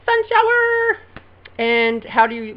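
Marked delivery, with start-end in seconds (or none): 0.0-1.0 high, bright exclaim; excited answer. Real, not acted. Answering her own quiz question, an excited exclaim. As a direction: burst out with the answer. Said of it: "what do you call it when it rains".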